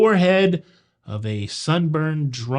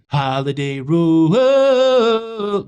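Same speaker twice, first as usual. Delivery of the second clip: slow, loud, higher sing-song announcer call; mock announcer; 6 semitones higher, 5 dB louder, much slower than usual. Host mocking the embezzler's European trip, loud drawn-out announcer. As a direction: stretch it out like a grand tour announcer.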